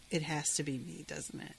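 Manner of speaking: low, hushed, resigned realisation; calmed down. Retold or imitated. Girl calms down after crying and yelling, quietly accepting. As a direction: let it sink quietly, trailing away softly. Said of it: "then she calms down".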